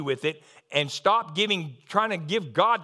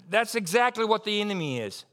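ordinary delivery

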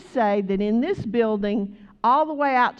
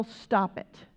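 usual speaking voice

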